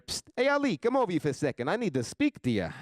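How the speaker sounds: conspiratorial mobster voice, opening high; mock mafia summons. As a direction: call him aside like a store mobster. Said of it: "I'm just gonna hear".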